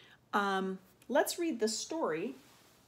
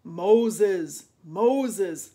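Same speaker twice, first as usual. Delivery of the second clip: booming call, much louder; 12 dB louder than usual. A narrator's reading. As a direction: call out the name like a voice from above. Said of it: "said a loud voice".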